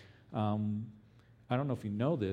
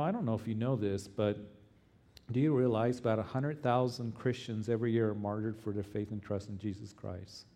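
ordinary talk, nothing marked

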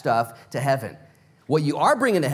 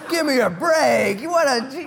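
higher, louder, slow mock exasperation; exasperated Abe; 10 semitones higher, 6 dB louder, slower than usual. Preacher playing Abe balking at Sarah's idea, slow and exasperated. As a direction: ham up a henpecked husband's protest. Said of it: "And poor Abe's like".

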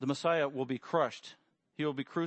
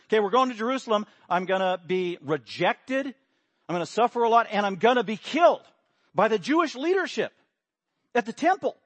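louder, higher, a preacher voicing Jesus; Jesus speaking loudly; 6 semitones higher, 7 dB louder than usual. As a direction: announce it boldly to the apostles. Jesus announcing death to apostles.

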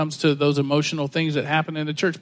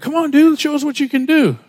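put-on higher and louder, hyped teen voice; excited skater kids; 12 semitones higher, 6 dB louder than usual. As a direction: cheer him on like a cocky kid at the park. Skater kids egging son on.

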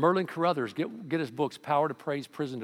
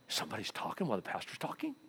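mock disapproving mutter; 3 semitones lower, 9 dB quieter, faster than usual. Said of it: "everybody's like".

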